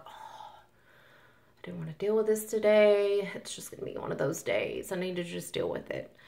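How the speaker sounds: whispered, drained sigh of defeat; mock exhausted whisper. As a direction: breathe it out like you're done for the day. Exhausted self after irate customer.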